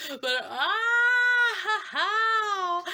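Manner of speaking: high, loud yelp; anxious shriek; nervous squeal. Real, not acted. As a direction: squeal with nerves.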